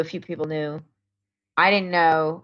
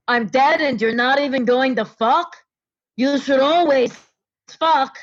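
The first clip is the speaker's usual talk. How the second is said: retold ghostly mock yell, pitched up; Gary Marshall booming; 6 semitones higher than usual. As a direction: boom it like a dead mentor yelling in her ear. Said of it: "Gary Marshall screamed in my ear".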